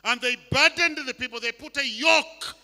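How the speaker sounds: loud, high, climbing preacher's cry; preaching at full force. Acted. Preacher denouncing the Pharisees' burden, loud and rising.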